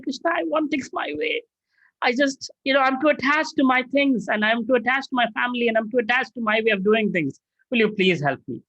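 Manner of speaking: hammed-up plea of a devotee who wants things his way; whiny attached prayer. A devotee at his stage begging Krishna, high and pleading. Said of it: "I'm like".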